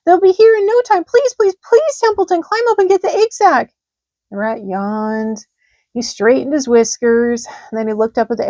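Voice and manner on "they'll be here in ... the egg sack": high, loud, rushing plea; high loud pleading pig; urgent pleading. A narrator's reading. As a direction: beg the rat in a hurry.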